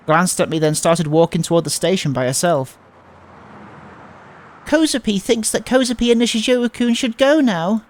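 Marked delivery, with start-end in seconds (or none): 4.6-7.8 put-on cutesy girl voice. Transfer student soothing Nishizuo-kun, high cutesy baby talk.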